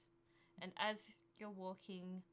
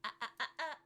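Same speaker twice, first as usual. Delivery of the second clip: higher, louder mocking ah-ah; teasing taunt; 7 semitones higher, 10 dB louder, much faster than usual. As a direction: tease him, keep it out of reach. Villain teasing player.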